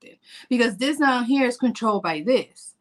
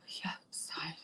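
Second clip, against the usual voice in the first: unvoiced, haunted whisper; hushed dramatic recall; 6 semitones lower, 21 dB quieter than usual. Real, not acted. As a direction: whisper it like a bad memory. Recalling mirror trauma.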